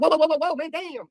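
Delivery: imitated high-pitched panicked backing off; alarmed. Delivery driver spooked by a charging dog, high mock alarm. Acting out man scared of dog.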